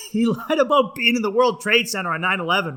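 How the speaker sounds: laughing. Real, not acted. Laughing while telling.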